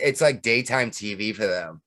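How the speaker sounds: ordinary talk, nothing marked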